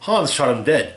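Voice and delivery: usual speaking voice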